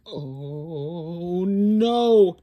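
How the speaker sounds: loud shocked cry of dismay. A narrator's reading. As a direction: gasp it out to the kids. Button pops off.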